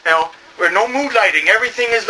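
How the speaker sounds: usual speaking voice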